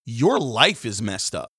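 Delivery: ordinary talk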